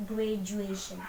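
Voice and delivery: "Graduation" with DU voiced as J; pronunciation demo. Shown as a demonstration. Teacher corrects pupils' reading, "Graduation" with a J sound. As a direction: model "Graduation" slowly, the DU like English J. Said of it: "Kayak J begitu ya".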